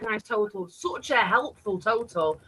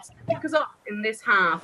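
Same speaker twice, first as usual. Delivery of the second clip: ordinary delivery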